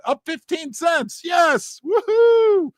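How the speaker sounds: excited cheer. Real, not acted. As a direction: cheer the price jump like a win. Celebrating silver price going up.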